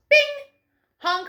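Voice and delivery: ordinary delivery, nothing marked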